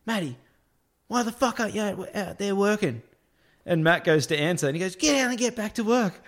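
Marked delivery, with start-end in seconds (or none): 0.0-3.0 high-pitched retold telling-off; mock boss yelling. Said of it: "came out and yelled at me". 5.0-6.2 high-pitched retold order to leave; mock boss yelling. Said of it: "yelled at me and told me to leave".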